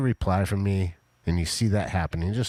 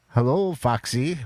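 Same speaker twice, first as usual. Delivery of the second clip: funky greeting; 5 semitones higher, 3 dB louder than usual. Acted. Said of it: "trying to be a little bit more funky".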